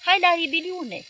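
slow, high, loud holler; a mob hollering in the street, high and loud. Retold or imitated. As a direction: howl it like a rioting crowd. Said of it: "ieșit înainte hăulind".

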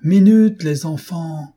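loud high holler to the gang; shouted. A narrator's reading. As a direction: call out cockily through the door, pitch way up. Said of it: "cria-t-il".

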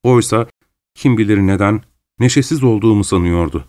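ordinary talk, nothing marked

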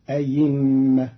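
أئمة as a-yimma, with a clear y; hamza turned to ya. Shown as a demonstration. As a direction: replace the second hamza of أئمة with y. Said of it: "وإبدالها ياء".

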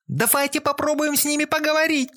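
very high shrieking plea; hysterical shouting. A narrator's reading. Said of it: "истерично кричала девушка".

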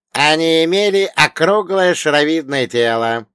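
put-on loud, high old man explaining; old dead mentor. A narrator's reading. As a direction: lecture your pupil as the dead elder.

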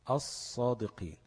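slow الصادقين, as-sadiqin with doubled ص; lam merged into sad. Shown as a demonstration. Teacher giving the merged result, slow الصادقين with held ص. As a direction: hold the ص, dropping the L completely. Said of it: "قلبت لصاد وادغمت الصاد".

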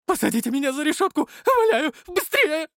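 very high frantic pleading; terrified wailing. Acted. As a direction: wail it out in panic. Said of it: "вопил толстяк". (панический вопль)

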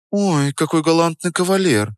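put-on high, girlish teasing voice; coy mock admiration, pitched high; giggly teasing. A narrator's reading. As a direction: tease him in a lightly giggly high voice. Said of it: "захихикала Настя".

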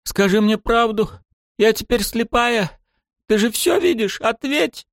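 crying, demanding the truth; angry crying. A narrator's reading. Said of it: "рыдая, кричала девушка".

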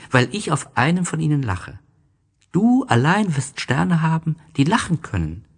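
put-on eager boy sharing a secret; bright little prince. A narrator's reading. Little Prince voiced in story. (kleiner Prinz, lebhaft und froh, höher)

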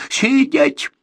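loud, high barked order; shout. Acted. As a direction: yell the command at him. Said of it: "крикнул Борзенко".

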